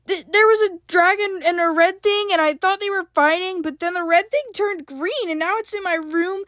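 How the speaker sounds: high, rushed, stumbling scared explanation; frantic, frightened. A narrator's reading. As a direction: tumble out the story in panic. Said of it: "Evelyn explained frantically, trembling a little".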